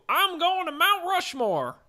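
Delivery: high, drawn-out mock champion's cry; Tom Brady. Host plays Super Bowl winner announcing his trip, hammed-up. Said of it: "That's my Tom Brady impression".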